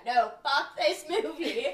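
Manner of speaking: emphatic. Real, not acted. Emphatic rejection.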